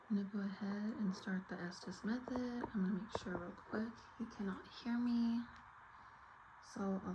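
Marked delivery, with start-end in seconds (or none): 0.1-4.6 hushed, careful whisper; nervous night vlog whisper. Real, not acted. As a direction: whisper so the spirits stay calm. Starting ghost-hunt session, whispering.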